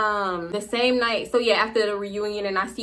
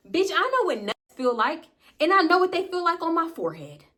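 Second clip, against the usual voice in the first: higher-pitched, emphatic comeback; insist, pitched up in disbelief; indignant insistence; 7 semitones higher than usual. Retold or imitated. Storyteller answers May's denial of what happened, indignant insistence.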